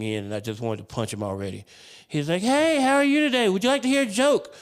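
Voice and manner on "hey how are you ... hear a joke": high, loud mock-cheerful nurse; mocking impression of a peppy nurse; chirpy nurse. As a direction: bounce in with an over-eager, high-pitched greeting. Imitating overly chirpy male nurse.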